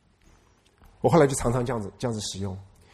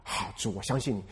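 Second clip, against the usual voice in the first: 1 near-whisper surrender; 6 semitones lower, 11 dB quieter than usual. Retold or imitated. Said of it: "answers the Lord".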